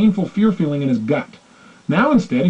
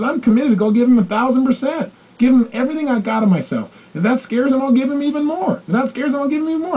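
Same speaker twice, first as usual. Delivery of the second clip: rising higher and higher with each promise; fervent vow; 8 semitones higher than usual. Retold or imitated. Retelling the wife's pledge, pitch climbing eagerly. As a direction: swear it with mounting fire. Wife vowing full commitment.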